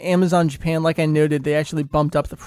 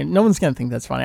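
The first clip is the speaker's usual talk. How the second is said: ordinary delivery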